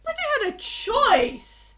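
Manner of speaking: high, slow, stretched protest; indignant protest. Acted. Player answering the king, high indignant protest. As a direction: drag it out in indignation.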